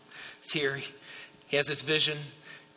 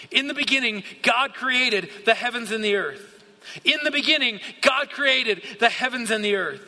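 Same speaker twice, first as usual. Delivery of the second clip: higher-pitched preacher's declamation, each phrase punched; emphatic proclamation; 5 semitones higher, 3 dB louder than usual. Real, not acted. Preacher declaring creation to the congregation, emphatic proclamation. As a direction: proclaim the verse with force, pausing between phrases.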